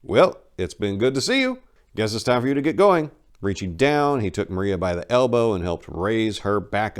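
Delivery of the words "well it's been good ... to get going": brisk, raised and a bit higher; polite dismissal, done with her; businesslike. Acted. Enrico showing Maria the door, businesslike. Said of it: "picking up a very businesslike tone".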